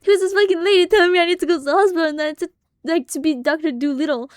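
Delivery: high, loud, panicked voice of dad; freaked out. Retold or imitated. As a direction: squeal it like a spooked dad, rushing. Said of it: "my dad was freaked out".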